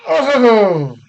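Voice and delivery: sudden loud, high exclamation; surprised. Real, not acted. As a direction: blurt it out, startled by news. Reacts to star ruled out.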